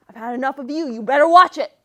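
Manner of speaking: scolding, building to a loud warning; mad parent. Acted. Parents mad at their child, angry scolding. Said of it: "If my parents were ever mad at me".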